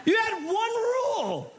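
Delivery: high slow exasperated cry. Real, not acted. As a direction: scold Adam in disbelief. Exasperated at Adam.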